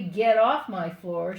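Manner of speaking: loud stern order from mother; mother scolding. Retold or imitated. Mother shouting at son to get off her floor. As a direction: bark it like an angry mom. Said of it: "She would say".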